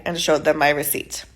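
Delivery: usual speaking voice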